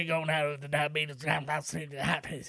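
mumbled, unintelligible character voice; Wilford Brimley. Retold or imitated. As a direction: slur it into a comic garble. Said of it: "but it's unintelligible".

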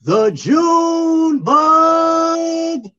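long stretched high announcer shout; boxer announcer voice. Pretend. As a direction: belt out the fighter's name. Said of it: "boxer announcer voice".

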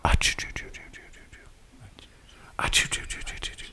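rapid unvoiced "choo" bursts fading out; soft mouth-made sound effect; whispered "choo" noises. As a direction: whisper a string of choos, tailing away. Imitated noise while getting ready.

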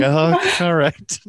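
high, drawn-out mock concession; retold sheepish acceptance. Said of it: "I was like".

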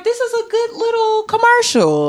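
high, sing-song approving line; mock delighted. Speaker mocking her own reaction to the ad, high and pleased. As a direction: play it up as charmed. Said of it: "I was like".

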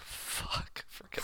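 whispered curse; whispering. Real, not acted. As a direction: whisper it under your breath. Sudden whispered swear.

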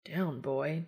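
softly scolding himself; muttered self-scolding. Acted. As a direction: mutter a chiding to yourself. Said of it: "Dean chastises himself".

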